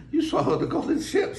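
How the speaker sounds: high mermaid voice. Acted. Mermaid exclaiming at the golden ship, high. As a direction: redo as the mermaid. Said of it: "said the mermaid".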